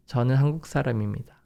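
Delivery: rattled off at native speed; fast natural Korean. Shown as a demonstration. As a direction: fire it off quickly, no pauses. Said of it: "It's gonna be very faster".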